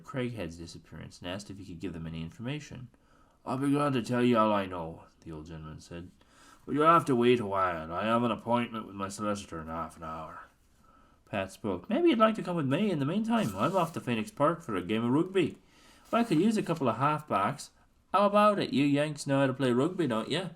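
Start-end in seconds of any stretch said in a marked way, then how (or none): 3.4-5.0 put-on old colonel. Old colonel speaking to the boys. 6.6-10.5 put-on old colonel. Old colonel speaking to the boys. 11.9-20.5 high, lively lad inviting friends to play; a keen young Irishman egging them on; eager Irish youth. Acted. Pat inviting the Hardys to rugby.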